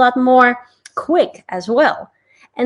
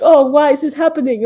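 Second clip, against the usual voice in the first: worried whine; 6 semitones higher, 6 dB louder than usual. Retold or imitated. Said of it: "Oh, like that".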